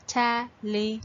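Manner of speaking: "Television" split into four evenly spaced beats; broken into syllables. Shown as a demonstration. Teacher repeats the syllable count, "Television" broken into parts. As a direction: pause after each syllable of "Television". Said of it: "you're breaking the word into parts".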